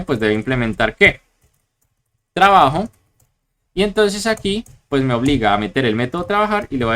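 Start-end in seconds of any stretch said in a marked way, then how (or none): none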